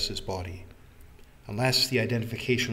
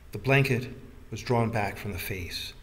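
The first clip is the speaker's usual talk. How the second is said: ordinary delivery, nothing marked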